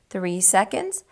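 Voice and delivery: plain reading voice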